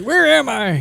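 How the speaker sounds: high, lost little question; confused old man. Retold or imitated. Friend imitating the grandfather, dazed and confused. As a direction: ask it like you've no idea where you are. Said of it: "And he's, he's like".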